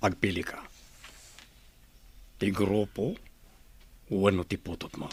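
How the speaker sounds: usual speaking voice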